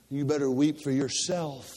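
ordinary talk

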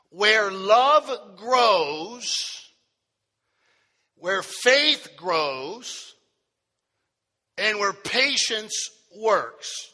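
very slow, stretched words with long pauses; proclaimed sermon title. Real, not acted. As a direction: proclaim each phrase and let it hang. Repeats title slowly for note takers.

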